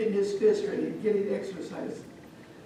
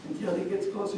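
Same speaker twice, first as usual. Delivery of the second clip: usual speaking voice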